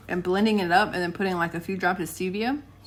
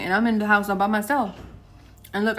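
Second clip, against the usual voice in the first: usual speaking voice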